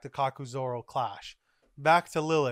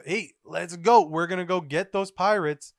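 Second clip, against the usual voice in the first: high, loud call to action; rally the troops loudly; raised, forceful marine leader; rallying shout; 4 semitones higher, 7 dB louder than usual. Acted. Garp rallies to chase pirates.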